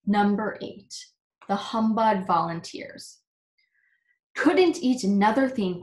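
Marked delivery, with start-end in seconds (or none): 4.4-5.8 puffing. A narrator's reading. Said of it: "puffed the dude".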